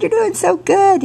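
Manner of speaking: high, bright praise for the pupil; teacher thrilled by his pupil's progress; excited praise. Retold or imitated. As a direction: cheer the student on, voice jumping up. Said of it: "Oh, he was so excited".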